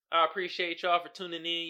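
ordinary talk

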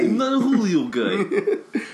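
put-on slow Brooklyn accent through laughter; laughing mock Brooklyn accent. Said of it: "with a Brooklyn accent".

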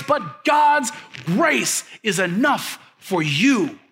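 loud, rising "But God" refrain; preaching climax. Acted. Pastor shouting the refrain to his church, loud. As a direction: shout the refrain, then land it slow.

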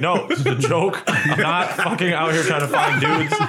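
laughing, high-pitched protest. Retold or imitated. Retold laughing denial.

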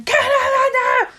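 very high-pitched imitation yell; dad screaming. Podcaster imitating dad as she turns dials, mock scream. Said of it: "my dad starts screaming at me".

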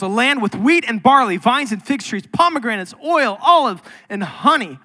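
full-force pulpit reading; scripture read with preaching force. Acted. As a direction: build the blessing to a climax.

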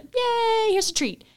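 high, sing-song praise for a puppy. Pretend.

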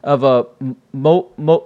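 ordinary talk, nothing marked

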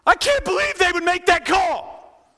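high, loud, slow outraged complaint; hammed-up couch fan; mock yell at the TV. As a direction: shout at the TV coach. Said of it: "screaming at the coach".

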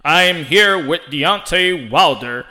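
slow, measured announcer voice; Ray Flores. Retold or imitated. Mimicking the announcer who never stops announcing, announcer voice. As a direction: draw it out like a ring announcer. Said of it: "sounds like he's always announcing".

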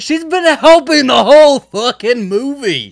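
loud, high exasperated yell; loud outraged shout at the movie; retold scream. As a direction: yell it at the screen. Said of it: "I screamed this".

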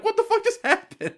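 high, squeaky mock disbelief; comically stunned, voice jumping high; retold bafflement. Retold baffled reaction to being yelled at.

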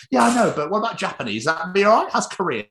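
imitating the wife. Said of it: "she's like".

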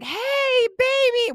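high, loud squealed greeting; squealing. Retold or imitated. Big sister greeting little sister on FaceTime, squealing. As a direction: imitate a delighted squeal on seeing a loved one. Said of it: "She starts squealing".

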